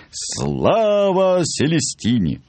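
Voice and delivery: high, slow, drawn-out acclamation; sudden bellowed cheer. A narrator's reading. Chief dentist hails Celestine before the clinic, high drawn-out yell. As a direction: stretch it out like a rallying cry. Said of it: "Вдруг заорал главный дантист".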